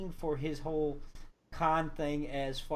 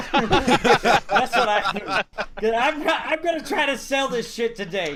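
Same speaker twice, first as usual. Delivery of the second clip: real laughter all through, louder; laughing; 6 semitones higher, 9 dB louder, slower than usual. Real, not acted. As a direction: laugh while bragging about the con. Laughing at his own con.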